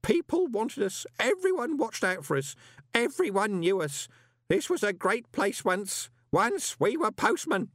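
put-on high, slow, emotional old postman; tearful pride. A narrator's reading. Grote recalls when postmen were heroes, tearfully proud. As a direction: remember lost glory through rising tears. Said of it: "Tears were beginning to roll down".

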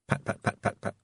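ordinary delivery, nothing marked

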